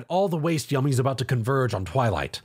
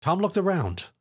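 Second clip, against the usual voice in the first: ordinary delivery, nothing marked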